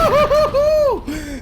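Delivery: high, loud, drawn-out whooping; excited whoop. Real, not acted. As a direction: whoop like you just won big. Thrilled by a new revolver.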